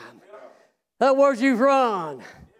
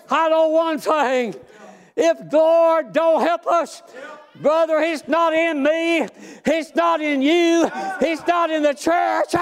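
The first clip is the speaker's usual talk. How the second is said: raised, shouting preacher cadence; preaching at full force; 4 semitones higher than usual. Acted. Preacher rousing his church, shouting with fervor. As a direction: preach it hard to the congregation.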